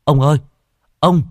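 boy bursting in crying to old Trình with bad news; whimpering panic. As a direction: sob out the bad news in a rush. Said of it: "chạy vào chỗ ông cụ Trình mếu máo".

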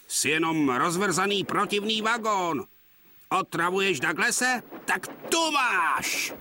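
angry scolding. Acted. Donald scolds and bumps wagon.

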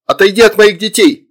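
high, loud angry order; protective mother. A narrator's reading. Mother orders demon away from children, fierce shout. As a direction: warn him off at full voice.

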